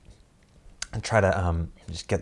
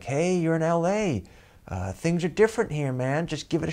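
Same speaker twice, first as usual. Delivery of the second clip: higher, sly tempting voice; shifty little inner voice; 8 semitones higher than usual. Retold or imitated. Inner voice urging him to stay on the date, sly and high. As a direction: egg him on like a devil on the shoulder. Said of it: "that little voice goes off".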